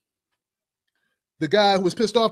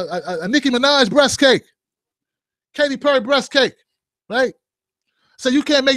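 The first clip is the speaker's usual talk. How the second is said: usual speaking voice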